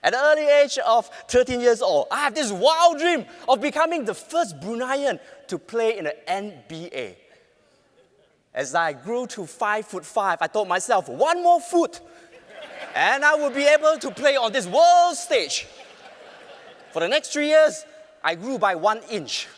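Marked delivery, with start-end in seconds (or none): 0.0-7.2 big projected stage voice; loud projection. Real, not acted. Projected speech opening. 11.1-15.7 high, loud determined vow; excited vow. Retold or imitated. Hopeful vow to himself.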